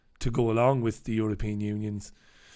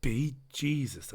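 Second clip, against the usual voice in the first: slower, lightly Irish "Be Jesus"; repeated Irish exclamation; 7 dB quieter, slower than usual. Retold or imitated. Repeating it to the listeners, a slow mock Irish exclamation. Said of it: "I said".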